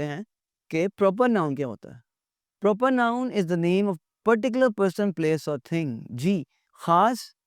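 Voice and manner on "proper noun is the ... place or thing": slow, deliberate dictation of a definition; reading a definition. Acted. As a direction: read it out word by word for the class. Reads English definition aloud. (परिभाषा धीरे-धीरे पढ़कर सुनाना)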